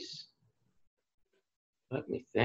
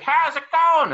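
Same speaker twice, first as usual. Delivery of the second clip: high, louder called greeting; 7 dB louder, slower than usual. Retold or imitated. Neighbor calls a greeting across the yards, high and loud. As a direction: shout it back across the yards. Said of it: "They're like".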